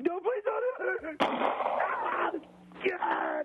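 high, slow desperate plea, then anguished cry; pleading then crying out. Acted. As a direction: beg for your life, then scream. Mother about to shoot him.

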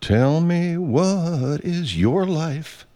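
slow, high, drawn-out dramatic plea; milk it like a soap opera lover; hammed-up romantic drama; melodramatic lover. Sudden dramatic put-on line.

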